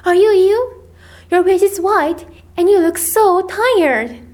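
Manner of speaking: high, worried exclaiming; worried cry. A narrator's reading. Ermengarde worries over her exhausted friend, worried cry. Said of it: "she cried".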